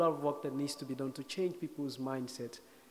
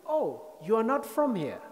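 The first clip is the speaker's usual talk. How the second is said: higher-pitched mock realization; sudden realization; 8 semitones higher, 4 dB louder than usual. Armed police realizing the man is a foreigner, voiced in sudden realization. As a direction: act out their dawning "oh", pitched up.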